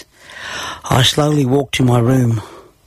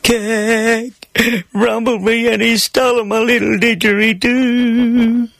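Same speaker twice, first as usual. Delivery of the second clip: put-on high, drawn-out child's wail; acted tearful tattling, high and whiny; weeping through sobs; 10 semitones higher, slower than usual. A narrator's reading. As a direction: blubber a slow complaint in a high voice. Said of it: "Between my sobs, I managed to weep".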